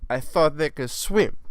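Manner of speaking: loud, high, drawn-out protest; mock excuse. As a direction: plead ignorance in a put-on voice. Voices the accused mother.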